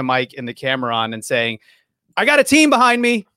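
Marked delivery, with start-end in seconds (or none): 2.2-3.3 high, loud bragging voice; boastful. Retold or imitated. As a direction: brag it like a big shot. Imagined podcaster boasting.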